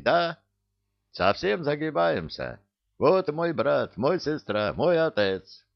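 put-on high-pitched pleading with a Caucasian accent; Givi, wheedling beggar. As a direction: beg theatrically, piling on hardship. (Гиви, жалобно клянчит высоким голосом с акцентом)

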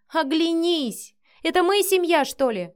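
bitter outburst. A narrator's reading. Wife disputing they are a family, bitterly. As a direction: challenge him, voice climbing high.

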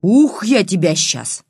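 loud, high angry order; furious threat. A narrator's reading. Grandmother threatening the girl with a stick, furious. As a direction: bark the order, draw out the threat. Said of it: "Снова рассвирепела старуха".

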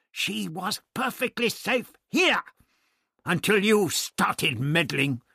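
high, loud, drawn-out reproach; angry accusation. A narrator's reading. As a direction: hammer the blame home slowly. Doctor blames Barbara, quarrel.

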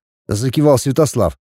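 ordinary delivery

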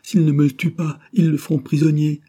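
high, loud tearful lament; sobbing outburst. A narrator's reading. Wife fearing for her husband, wailing high and loud. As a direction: wail it as if in sobs. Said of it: "Félicité éclata en sanglots".

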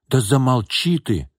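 slow, emphatic order to hush; scolding, but not shouted; snapping rebuke. A narrator's reading. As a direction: silence her crossly, pitch lifted. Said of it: "прикрикнула на неё Тамара".

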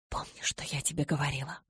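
soft, voiceless whispered reminder. A narrator's reading. Mother reminding her daughter before parting, whispered. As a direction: whisper it intimately.

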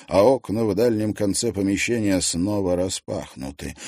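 usual speaking voice

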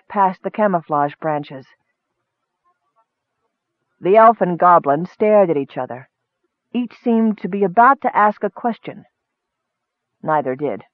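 plain reading voice

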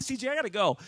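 loud, urgent excuse to hang up; retold panic. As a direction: blurt it out in mock alarm. Retells cutting the call in panic.

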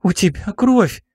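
high shocked exclamation; alarmed. Acted. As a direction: gasp it out in alarm. Girl sees his blood.

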